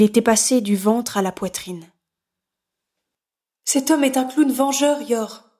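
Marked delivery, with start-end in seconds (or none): 3.6-5.5 helpless. Acted. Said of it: "sur un ton d'impuissance".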